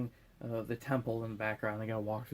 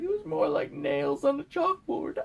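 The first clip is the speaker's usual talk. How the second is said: much higher and louder, like a squawking henchman; high screechy bird voice; 4 dB louder than usual. Retold or imitated.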